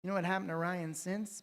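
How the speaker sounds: ordinary talk, nothing marked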